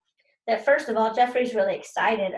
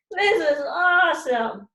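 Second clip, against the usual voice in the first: loud, thrilled cry; excited yell; 6 semitones higher, 6 dB louder, slower than usual. Retold or imitated. Peter cheering at the smoking mess. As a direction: whoop it out with glee. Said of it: "he yelled".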